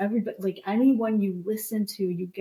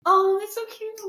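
high delighted coo; squealing that's so cute; gushing aw; 14 semitones higher, 3 dB louder than usual. Real, not acted. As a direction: coo like it's adorable. Reacting to talking dog voice.

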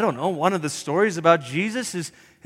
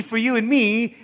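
ordinary delivery

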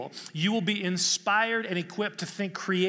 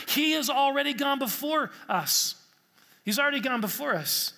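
higher, louder preaching that climbs to a peak; fervent preaching; 5 semitones higher, 3 dB louder than usual. Acted. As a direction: drive the point home from the pulpit. Preaching at full force.

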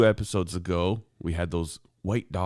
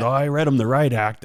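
neighbor's boast; 7 semitones higher, 7 dB louder than usual. Retold or imitated.

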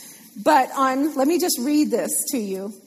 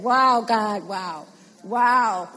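low, wondering wows ending in a chuckle; imitated amazed laughter; 4 semitones lower, much slower than usual. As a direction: marvel at God, breaking into a laugh. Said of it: "Start laughing like Abraham and Sarah did".

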